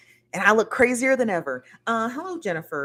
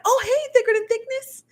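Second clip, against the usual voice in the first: high, bright squeal of recognition; excited greeting; 3 dB louder than usual. Real, not acted. Greeting a viewer she recognizes, excited squeal.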